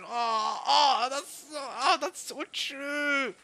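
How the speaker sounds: high, drawn-out wail of recognition; wailing agreement. Real, not acted. Younger sibling relating to the character, high wailing agreement. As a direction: moan it high, stretching the last word.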